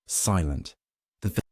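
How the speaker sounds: ordinary delivery, nothing marked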